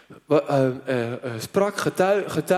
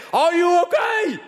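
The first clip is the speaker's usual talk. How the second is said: shouting across the noise; 10 semitones higher, slower than usual. Retold or imitated. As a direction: yell it to someone who can't hear you. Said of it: "heen en weer te schreeuwen".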